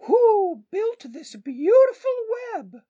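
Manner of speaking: very high, loud, slow owl voice. Acted. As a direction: hoot the question like an owl. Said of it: "asked the owl".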